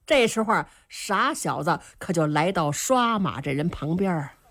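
ordinary delivery, nothing marked

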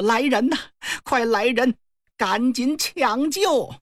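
high, urgent call for help. Acted. Queen calls for rescue.

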